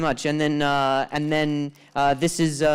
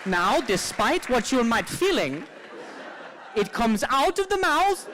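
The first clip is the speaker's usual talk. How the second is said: put-on higher, slow, sing-song Swedish accent; mock Swedish accent; 13 semitones higher, slower than usual. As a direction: read it like a dreamy Swede. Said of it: "I'm not very good with accents".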